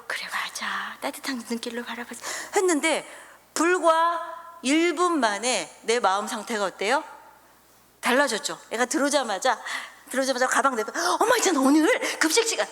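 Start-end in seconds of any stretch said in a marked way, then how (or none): 0.1-2.2 조용한 혼잣말. Retold or imitated. 혼잣말로 다짐 회상. 11.2-12.7 높은 목소리로 들뜬 아이; 엄마한테 신나서 말하는 아이처럼. Retold or imitated. 아이 목소리 흉내.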